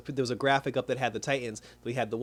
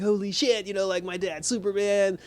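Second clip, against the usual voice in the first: put-on pitched-up awed kid voice; excited kid; 7 semitones higher than usual. Son discovering his dad is Superman, pitched-up awe. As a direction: play the son starstruck.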